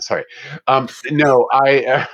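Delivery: ordinary delivery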